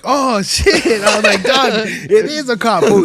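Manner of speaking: high, loud, panicked laugh-cry; panicking as the cop spotlight lands; alarmed panic. Retold or imitated. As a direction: freak out as the spotlight hits. Spotlight hits their car.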